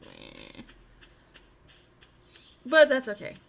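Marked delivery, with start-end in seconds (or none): 0.0-0.6 very low, grumbling, reluctant voice; a sulky, deep put-on groan of refusal; grumpy refusal. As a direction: drop way down and grumble the no. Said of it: "I'm like".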